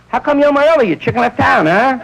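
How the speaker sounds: sarcastic wife. Retold or imitated. Wife mocking husband.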